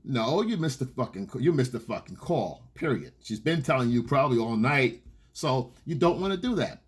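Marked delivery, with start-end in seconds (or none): none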